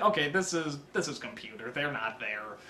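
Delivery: deflate, muttering to yourself; unimpressed aside. Retold or imitated. Viewer seeing fake CGI effects, soft deadpan mutter.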